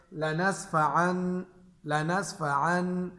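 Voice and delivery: لَنَصْفَعًا with a clear N at the end; لَنَصْفَعًا ending in a plain noon sound; wrong tanween sound. Shown as a demonstration. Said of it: "یہ تنوین کی آواز آ گئی".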